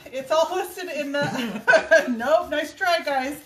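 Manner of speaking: loud, laughing nope, nice try; laughing refusal. Real, not acted. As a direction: brush off the question with a big laugh. Refusing to repeat colour list.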